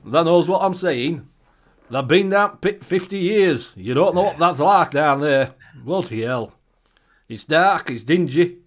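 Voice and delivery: put-on thick Yorkshire miner accent, loud and proud; hamming up a broad Yorkshire lad. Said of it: "I could go proper Yorkshire".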